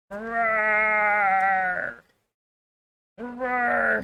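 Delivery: playful fake roar with a rise at the end; mock roar. Streamer roaring playfully at her dog, mock roar. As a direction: growl it out to tease the dog like a pretend monster.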